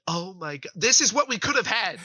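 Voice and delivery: a sudden loud cry of dismay; dismayed outburst. Retold or imitated. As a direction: throw up your hands in mock despair. Exasperated retold reaction.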